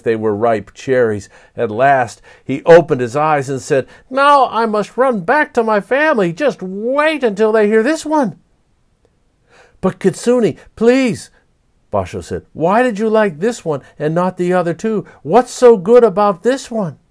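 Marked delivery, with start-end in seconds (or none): 4.1-8.4 high, loud eager fox voice; excited fox. A narrator's reading. Fox leaving to tell its family, high and eager. 9.8-17.0 higher, louder plea; pleading poet. A narrator's reading. Basho calling after the departing fox, pleading.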